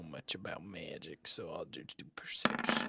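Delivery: soft unvoiced aside to the table; whispering. Real, not acted. Player drops to a whisper.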